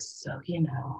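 whispered, as if starting a serious talk; serious hushed whisper. Retold or imitated. Aunt whispering to niece before a serious talk. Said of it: "I get serious".

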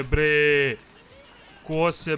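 usual speaking voice

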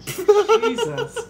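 high, loud laughing gasp of shock. Real, not acted. Friend stunned by the rant, laughing loud and high. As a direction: react in laughing disbelief. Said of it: "That was bottled up".

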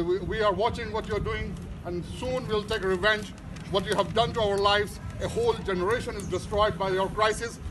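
ordinary delivery

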